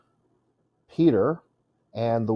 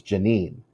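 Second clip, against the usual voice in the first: "Janine" as juh-NEEN; weak first syllable in "Janine", juh-NEEN; stress on second syllable; 3 semitones lower than usual. Shown as a demonstration. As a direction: hit NEEN in "Janine", long vowel. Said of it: "Second syllable is stressed".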